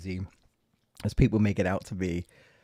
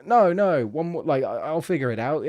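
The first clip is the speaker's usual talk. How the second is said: loud, higher insistent pleading; stubborn loud protest; 4 semitones higher, 6 dB louder than usual. Retold or imitated. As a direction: insist loudly that you'll crack it. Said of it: "and you're like".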